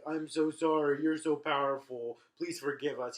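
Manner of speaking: begging the powerful duke; groveling traitors. Retold or imitated. The traitors beg Prospero to forgive them, loud and slow. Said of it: "these guys are like".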